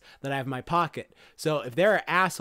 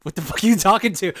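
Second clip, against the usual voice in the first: louder, fast confused shout; baffled villain yell; 6 semitones higher, 12 dB louder, faster than usual. Retold or imitated. Retelling a villain yelling at the turtles, baffled shout. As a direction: yell it at someone talking to nobody. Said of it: "like, walking, like".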